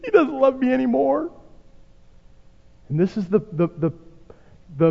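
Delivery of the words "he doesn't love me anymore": high, frightened complaint; a prophet panicking that God has left; freaked out. Acted. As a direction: Elijah under the tree, high and scared. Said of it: "he's freaked out".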